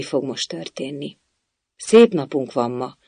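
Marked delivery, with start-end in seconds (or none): none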